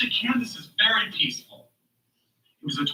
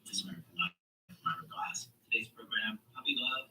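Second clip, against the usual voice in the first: low, soft radio presenter voice; public radio host; 6 semitones lower, 5 dB quieter than usual. Acted. Host introducing a Puppy Love episode, hushed radio voice. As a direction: introduce the programme softly and low.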